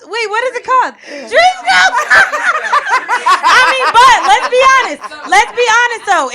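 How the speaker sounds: high, loud laughing through words; giggling fit; laughing hard. Real, not acted. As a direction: cackle while you fix the title. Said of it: "Oh, my God".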